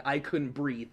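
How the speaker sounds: ordinary delivery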